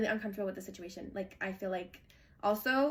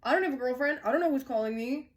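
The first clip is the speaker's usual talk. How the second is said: mock insistent denial; 3 semitones higher, 5 dB louder than usual. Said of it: "He didn't say".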